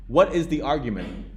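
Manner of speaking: ordinary talk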